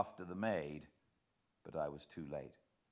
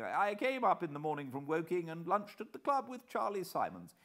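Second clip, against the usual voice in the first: higher, louder hearty gentleman; plump man, bluff; 8 semitones higher, 4 dB louder than usual. A narrator's reading. Plump man explains his alibi, hearty and higher. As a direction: rattle off the alibi breezily.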